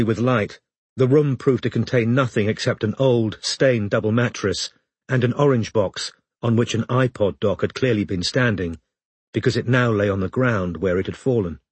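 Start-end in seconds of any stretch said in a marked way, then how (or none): none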